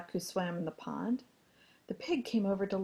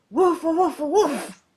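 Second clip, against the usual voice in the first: loud woofs rising in pitch; dog barking; 8 semitones higher, 12 dB louder than usual. A narrator's reading. Dog trying to crow for rooster, loud woofing. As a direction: woof out at full voice. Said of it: "The dog gave them".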